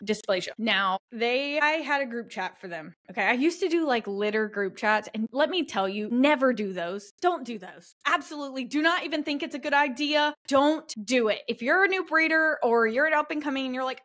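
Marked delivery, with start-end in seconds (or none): none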